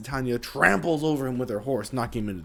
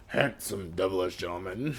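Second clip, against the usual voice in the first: lower, mock suave pirate; 6 semitones lower, 5 dB quieter than usual. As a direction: ham up the charm.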